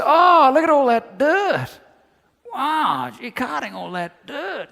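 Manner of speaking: high, loud, slow gushing over the dirt; a gawking visitor impressed by dirt; mock awed onlooker. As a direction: ham up a wide-eyed tourist. Mock naive onlooker at mine.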